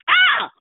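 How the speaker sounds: sudden high, loud cry of release; ecstatic cry. Retold or imitated. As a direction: burst out in a loud cry of relief. Recalls moment of breakthrough.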